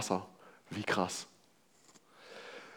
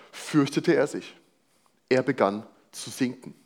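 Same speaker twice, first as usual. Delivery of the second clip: scripture reading; 2 semitones higher than usual. Acted. Reads the passage aloud again.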